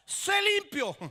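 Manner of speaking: high, firm command; Jesus heals the leper with one word. Acted. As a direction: speak the healing word with authority.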